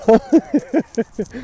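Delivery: brief helpless chuckle; short laugh. Real, not acted. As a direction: laugh it off mid-rant. Laughs at own angry rant.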